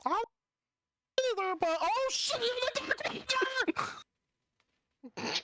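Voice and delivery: high, slow, frightened squeal; panicked. Acted. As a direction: shriek it in terror. Character panics seeing Lich.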